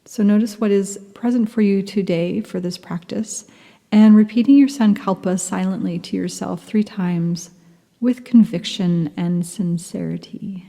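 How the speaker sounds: ordinary talk, nothing marked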